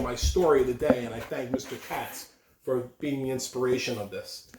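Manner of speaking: ordinary talk, nothing marked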